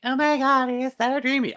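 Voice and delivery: high-pitched gushing swoon; swooning fan. Acted. Mocking an Orlando Bloom admirer, high swooning fan voice. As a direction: squeal it like a starstruck fan. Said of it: "I've never been an Orlando Bloom, like".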